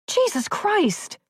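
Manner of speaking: very high, slow, drawn-out exclamation; stretched, alarmed curse; shocked outcry. A narrator's reading. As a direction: cry out in alarm. Shocked outcry as gun leveled.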